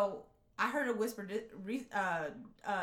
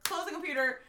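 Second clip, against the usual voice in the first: panicked; 8 semitones higher, 6 dB louder, faster than usual. Retold or imitated. Retelling a panicked moment.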